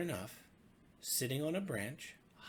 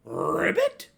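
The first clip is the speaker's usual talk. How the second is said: loud questioning croak; froggy ribbit; 6 semitones higher, 15 dB louder than usual. Acted. Little pig answering the frogs with a loud questioning croak. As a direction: croak it, unsure. Pig's only word, frog-like.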